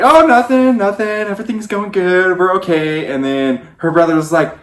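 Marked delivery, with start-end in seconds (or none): 0.0-3.1 innocent cover-up. Retold or imitated. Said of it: "We're like".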